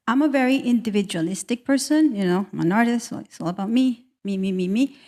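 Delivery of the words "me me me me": ordinary talk, nothing marked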